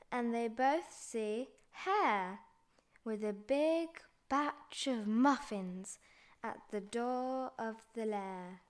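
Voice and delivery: usual speaking voice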